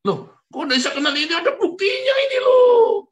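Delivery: high, loud outraged cry; indignant protest. Retold or imitated. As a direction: shout in disbelief that you're rejected. Rejected believer protests to God.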